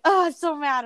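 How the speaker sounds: retold mad friend. Quoting the friend who rarely gets mad, mock exasperation. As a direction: imitate a friend venting, lightly. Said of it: "She doesn't get mad very often".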